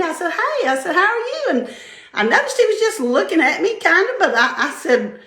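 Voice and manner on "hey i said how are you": put-on high, sing-song hello to a child; friendly greeting. Woman greeting a small boy at a party, high and sweet. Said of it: "he was just so friendly".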